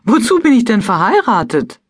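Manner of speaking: high-pitched, affronted question; indignant. A narrator's reading. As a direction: throw it back indignantly. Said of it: "sagte ich empört". (empört)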